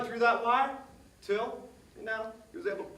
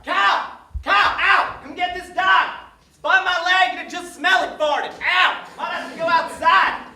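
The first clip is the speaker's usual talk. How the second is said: loud high yelling at the dog; shouting for help; 9 semitones higher, 12 dB louder than usual. Real, not acted. Kid yelling for help with the farting dog. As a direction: yell for someone to come get the dog.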